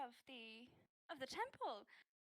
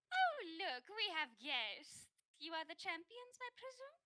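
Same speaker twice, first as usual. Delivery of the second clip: a hint of a grand, welcoming priestess; lightly voiced priestess; 5 semitones higher, 5 dB louder than usual. High priestess greeting the battered champions, lightly voiced. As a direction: greet the guests with a touch of ceremony.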